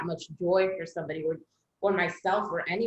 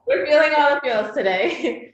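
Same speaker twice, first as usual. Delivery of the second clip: excited; 8 semitones higher, 10 dB louder than usual. Real, not acted. Laughing after chills talk.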